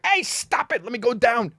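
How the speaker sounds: a hint of the wife saying stop; wife calming him. Wife stopping him kicking door.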